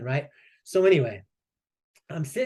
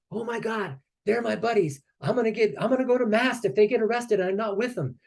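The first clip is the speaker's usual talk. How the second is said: high-pitched mock panic; imitated panicked seaman; 7 semitones higher than usual. Seaman running up as his buddies get arrested, imitated high panic. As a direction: fret in a raised voice, imitating a worried sailor.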